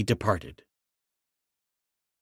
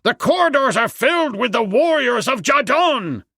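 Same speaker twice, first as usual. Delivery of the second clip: very high, panicked shout of alarm; frightened cry; 10 semitones higher, 4 dB louder than usual. A narrator's reading. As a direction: raise the alarm at the top of your range. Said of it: "he cried".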